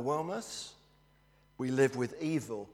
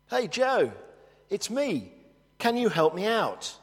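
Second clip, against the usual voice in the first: much higher, louder, eager call; 7 semitones higher, 3 dB louder than usual. Retold or imitated. Man in the hole calls his friend Joe, eager shout. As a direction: call up to an old friend, hopeful.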